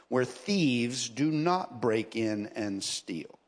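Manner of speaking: slow, low-pitched Bible reading; scripture reading. Acted. Preacher reading about treasures in heaven to the congregation, slow scripture reading. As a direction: read the verse with weighty, drawn-out phrasing.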